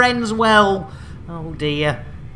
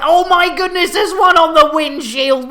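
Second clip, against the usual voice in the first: higher, louder startled cry; mock panic; 7 semitones higher, 5 dB louder than usual. Host spotting a tot on the windshield, mock panic. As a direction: yelp a hammed-up warning.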